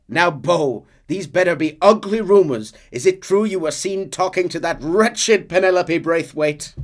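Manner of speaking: hammed-up lawman's scolding; scolding sheriff. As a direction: play a sheriff confronting a young man. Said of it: "we can hear the sheriff say to him".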